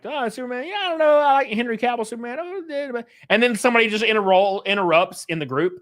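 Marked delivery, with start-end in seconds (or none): 0.0-3.1 much higher, quick chatty voice; mock eager friend. Friend in the group gushing about Superman, higher and quick. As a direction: rattle it off like an excited fanboy. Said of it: "they start like".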